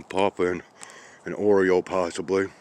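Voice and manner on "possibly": ordinary talk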